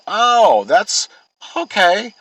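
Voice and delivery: a mock-innocent high voice, like she's just caught on; high mock-girly voice. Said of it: "She goes".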